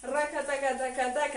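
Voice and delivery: rapid "rakataka" percussion with the mouth; rhythmic vocal rattle. Shown as a demonstration. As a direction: sound out the hip trembling beat. Teacher voices the trembling rhythm.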